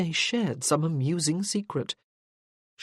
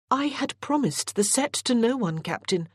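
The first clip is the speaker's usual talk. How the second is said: sharp reminder; 7 semitones higher than usual. A narrator's reading. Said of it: "Morgan reminded him sharply".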